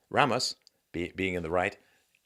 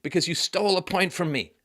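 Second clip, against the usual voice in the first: high-pitched mock disbelief; acted wounded protest in a raised pitch; angry, almost tearful; 8 semitones higher than usual. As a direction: imitate someone outraged and near tears. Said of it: "really angry. She was almost in tears".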